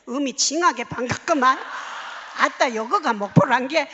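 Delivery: put-on loud, hearty Jeolla accent; Jeolla-accented mock announcer; Jeolla dialect announcement. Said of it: "이 방송이 전라도".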